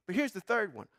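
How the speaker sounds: ordinary delivery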